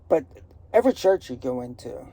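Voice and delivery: ordinary delivery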